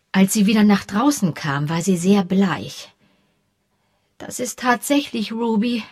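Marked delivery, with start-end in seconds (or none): 4.2-5.8 slight tremble in the voice; a hint of shaken grief; understated shaky confirmation; trembling voice. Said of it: "mit zitternder Stimme".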